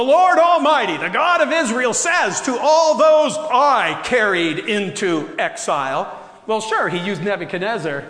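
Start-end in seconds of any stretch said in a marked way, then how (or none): 0.0-6.2 loud, booming proclamation; booming voice from sky. Acted. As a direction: boom it out like God from above. Booming reading of God's words.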